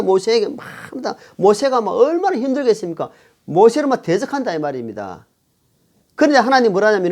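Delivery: ordinary delivery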